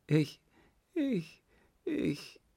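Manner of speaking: very high, faltering repeats; acted tearful girl, pitch shooting up; frightened stammer. A narrator's reading. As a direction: stammer helplessly, climbing to a squeak. Weeping girl stammers under interrogation.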